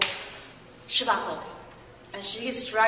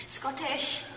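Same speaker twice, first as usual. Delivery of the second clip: Scottish accent; 4 semitones higher, 3 dB louder than usual. Shown as a demonstration. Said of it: "And what sort of accent is that?".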